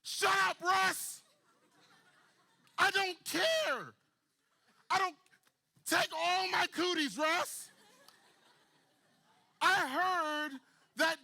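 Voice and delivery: very high, loud, slow shouting; woman shouting down the crowd; hammed-up yell. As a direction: yell back like a stubborn kid. Woman yelling back at bystander.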